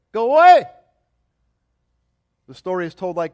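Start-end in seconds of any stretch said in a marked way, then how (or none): 0.1-0.6 sudden loud, high yell; shouted dismissal. Acted. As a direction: throw him out with a shout. King drives Alexander from gate.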